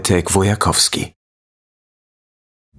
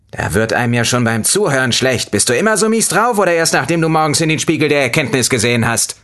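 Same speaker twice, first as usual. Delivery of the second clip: higher, snide fruit voice; snide lemon voice; 8 semitones higher than usual. A narrator's reading. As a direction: sneer it as the fed-up lemon. Lemon character mocking.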